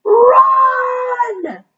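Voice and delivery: high, loud yell; sudden high yell of alarm. A narrator's reading. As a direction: shout a warning to flee. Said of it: "Desmond yelled".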